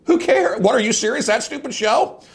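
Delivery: high-pitched disbelieving outburst; exasperated mock amazement; incredulous scoffing. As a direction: scoff at them, voice leaping up. Scoffing at upset radio listeners.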